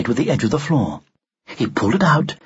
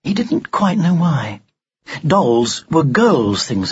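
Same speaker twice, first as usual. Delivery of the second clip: usual speaking voice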